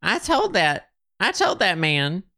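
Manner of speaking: an exasperated I-told-you-so; hammed-up told you so; mock told-you-so. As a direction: voice jumps high on TOLD. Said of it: "I'd say".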